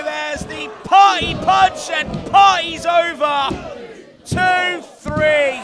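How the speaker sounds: climactic shout. Real, not acted. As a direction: roar the three count and the win. Match-ending pin call.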